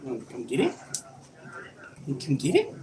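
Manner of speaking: very soft low coaxing to the dog. Real, not acted. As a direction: tease the dog gently. Teasing dog with toy.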